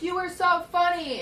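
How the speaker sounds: high, loud call across a distance; yelled compliment. Retold or imitated. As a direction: imitate a friend yelling praise over a car. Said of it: "yells over the car".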